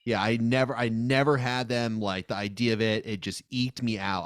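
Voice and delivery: normal talking voice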